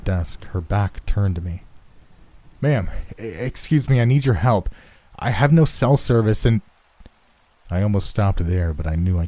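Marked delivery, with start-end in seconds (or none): 2.6-6.6 higher-pitched, flustered appeal, stumbling at first; urgent plea for help. Acted. Man begging the receptionist for help, urgent and flustered. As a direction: plead urgently, building in force.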